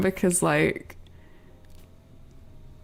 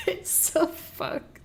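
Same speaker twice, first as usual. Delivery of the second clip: laughing; 10 semitones higher, 7 dB quieter, much slower than usual. Real, not acted. Laughing at the broken model.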